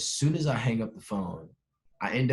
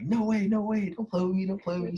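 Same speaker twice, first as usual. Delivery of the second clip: high, rapid-fire incredulous protest; pleading disbelief, pitched well up; 11 semitones higher than usual. Retold or imitated. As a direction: reenact refusing to believe it. Acting out disbelief at news.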